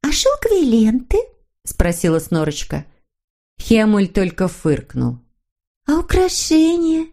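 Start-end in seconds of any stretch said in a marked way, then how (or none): 0.0-1.2 put-on high-pitched girlish creature voice; Snork Maiden. Little creature suggesting silk ribbons to Hemulen, high voice. 5.9-7.1 high, drawn-out hopeful question. A narrator's reading. Snork Maiden making another suggestion to Hemulen, hopeful. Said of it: "с надеждой продолжила она".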